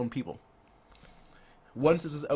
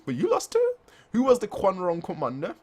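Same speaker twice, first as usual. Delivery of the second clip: very high, loud disbelieving question; high mock shock; shocked squeak; 7 semitones higher, 4 dB louder than usual. Voicing shocked commander.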